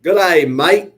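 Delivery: loud, high, hammed-up Aussie greeting; big put-on Australian twang; Australian accent. Shown as a demonstration. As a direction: call it out in a broad Australian accent. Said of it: "in an Australian accent".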